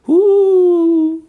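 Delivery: high, loud ghostly hoo; a sudden shrill spooky call; spooky hoot. Acted. A toad calls from the moor, high and loud.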